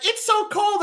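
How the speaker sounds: high whiny mock complaint; mocking cold woman. Man mocking a woman at seventy-eight degrees, high whiny mimic. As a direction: pitch it up and whine the word so. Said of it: "and they're like".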